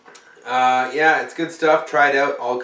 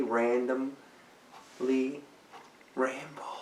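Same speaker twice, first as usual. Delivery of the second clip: softer, then a whispered ramble; quiet, fading to whisper; 7 dB quieter than usual. Shown as a demonstration. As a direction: let it fade out into a whisper. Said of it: "I'm gonna randomly ramble".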